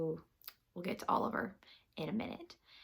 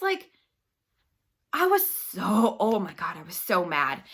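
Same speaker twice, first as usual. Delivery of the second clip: usual speaking voice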